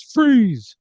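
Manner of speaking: high, loud order to halt; shouted command. Acted. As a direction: shout it at a fleeing intruder. Pursuer orders player to stop.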